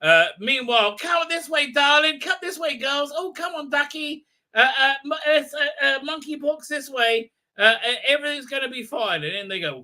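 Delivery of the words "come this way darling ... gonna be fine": high, flamboyant camp coaxing; sing-song camp "come this way, darling"; camp Thal leader. Retold or imitated. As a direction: usher them in like a fussy camp host. Camp Thal leader herding the group.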